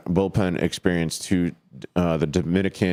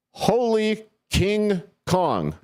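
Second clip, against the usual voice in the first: hyped exclamation; 13 semitones higher, slower than usual. Acted. Excited about home runs.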